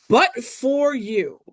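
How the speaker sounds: "but for you" drawn out slowly; slow translation. Shown as a demonstration. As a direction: spell out the meaning slowly for the class. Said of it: "at Tibi means".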